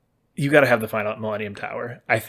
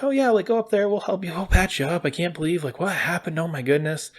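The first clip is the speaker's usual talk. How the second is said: caring townsfolk; 7 semitones higher than usual. Retold or imitated. Kind townsfolk voiced.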